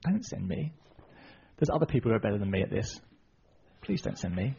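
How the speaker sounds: soft, reluctant excuse-making; timid plea. Retold or imitated. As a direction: plead quietly to be let off. Moses keeps pleading to be excused.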